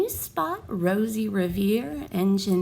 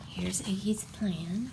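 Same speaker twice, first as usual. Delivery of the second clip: whisper; 3 semitones lower, 9 dB quieter than usual. Real, not acted. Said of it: "whispered aside".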